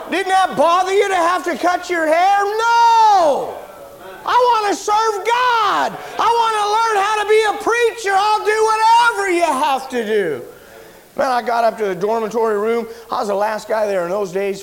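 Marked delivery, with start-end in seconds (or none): 0.1-2.4 high, loud disbelieving barber; incredulous shout. Retold or imitated. 2.4-10.4 very high, loud, slow zealous cry; fervent shout. Retold or imitated. His zealous reply, shouted.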